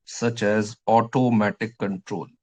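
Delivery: words stretched out slowly for note-taking; slow dictation. Shown as a demonstration. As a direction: spell it out slowly for the class to write. (धीरे-धीरे लिखवाना)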